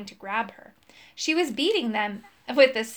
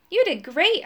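usual speaking voice

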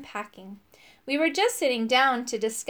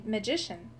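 usual speaking voice

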